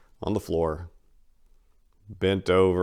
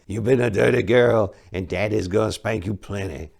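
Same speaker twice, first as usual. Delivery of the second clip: off-voice, leering tease; leering old man; 4 dB louder than usual. Acted. The old man teasing Maria on the bus, leering. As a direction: entice her, lewd and sly. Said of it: "enticed Maria further".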